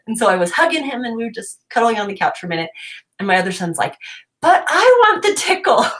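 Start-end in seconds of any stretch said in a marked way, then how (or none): 4.4-5.9 high, loud imitated kid's plea; pleading child. Other son wanting the tickle for himself, pleading. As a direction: pipe up like an eager little boy.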